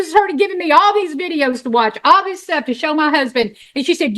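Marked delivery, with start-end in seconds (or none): none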